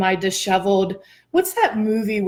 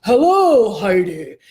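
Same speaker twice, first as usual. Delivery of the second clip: hammed-up heavenly greeting, drawn out; God/character; 5 dB louder, slower than usual. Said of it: "it's not like he says".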